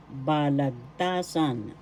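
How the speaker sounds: slow "Balagtasan" as ba-lag-TA-san; third-syllable stress. Shown as a demonstration. Teacher models the new word "Balagtasan", slowly, stressed on ta. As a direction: stretch the "ta" in "Balagtasan". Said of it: "The third syllable is where the accent is".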